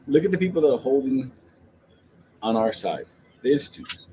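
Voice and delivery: ordinary talk, nothing marked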